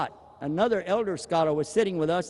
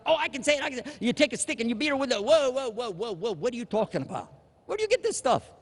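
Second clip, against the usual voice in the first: eager know-it-all; 6 semitones higher, faster than usual. Retold or imitated. Mocking a rash answer, then objecting.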